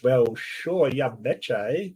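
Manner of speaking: hammed-up Canadian accent; badly faked Canadian, played for a laugh; terrible Canadian accent. Co-host teasing Justin from Canada, terrible Canadian accent. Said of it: "That's a terrible accent".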